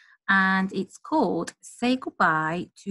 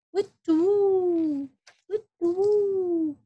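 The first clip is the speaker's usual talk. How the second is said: high slow hooting owl; a long high owl call; 11 semitones higher, slower than usual. Pretend. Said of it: "Barn owl calls".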